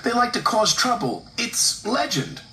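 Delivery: high-pitched worried engine; alarmed storybook character; anxious insistence. A narrator's reading. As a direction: insist earnestly, drawing out the end. Percy insisting to the boss.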